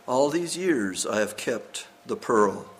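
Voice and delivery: low, restrained sorrow; subdued grief. A narrator's reading. Old diver speaking of his dead son's pearl, subdued grief.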